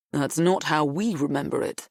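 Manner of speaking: sneering. A narrator's reading. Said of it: "says Patrick with a sneer".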